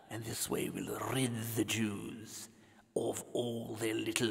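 hushed, scheming whisper; conspiratorial whisper. Acted. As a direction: whisper the evil plan so no one hears. Pharaoh plotting in secret.

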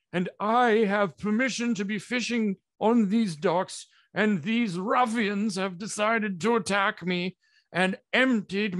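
high, loud, indignant wizard voice; wizard hollering. Acted. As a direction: holler out a slow, drawn-out complaint to the guards. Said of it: "hollers out and he says".

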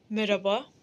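a hint of a courteous gent; gentlemanly greeting. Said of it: "efendi diye konuşuyordun".

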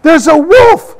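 high, loud frightened cry. A narrator's reading. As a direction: cry out in alarm. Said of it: "the boy cried".

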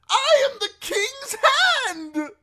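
high, slow, loud proclamation; desperate shout. A narrator's reading. Prisoner claims his rank as guards leave, desperate shout. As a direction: bellow it in a high voice, each word drawn out. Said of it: "the prisoner shouted at their retreating backs".

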